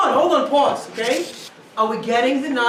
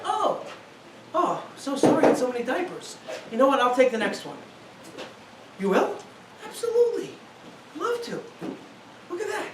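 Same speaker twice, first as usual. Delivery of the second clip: soft, tender offer to help; gentle apology; 7 dB quieter than usual. Acted. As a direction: apologize softly and sweetly.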